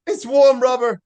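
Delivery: loud, high mock outburst; retold snap. Host echoing co-host's impression of the woman who snaps, mock yelling. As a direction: yell it in exaggerated imitation of her snapping. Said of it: "she snaps and just goes".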